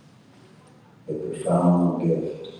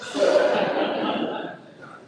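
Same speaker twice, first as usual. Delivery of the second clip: hearty laugh. Real, not acted. Storyteller amused at the first two minutes, laughing.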